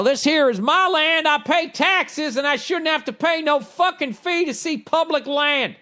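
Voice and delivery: high-pitched, hammed-up Southern twang; indignant mock Southerner, high voice; bad Southern accent. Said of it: "I'll do my bad Southern accent".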